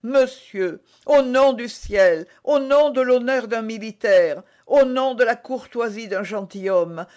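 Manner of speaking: put-on young woman begging him urgently; pleading. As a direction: beg him to leave. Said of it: "suppliant et joignant les mains".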